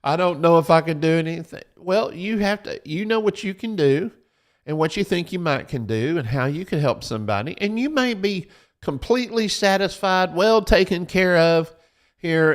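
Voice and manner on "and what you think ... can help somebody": ordinary talk, nothing marked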